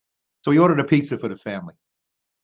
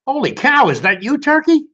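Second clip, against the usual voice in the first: high startled pig, pitched way up; surprised pig voice; 10 semitones higher, 4 dB louder than usual. Acted. Pig spotting Turkey in disguise, high and startled. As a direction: gasp it out like a shocked pig.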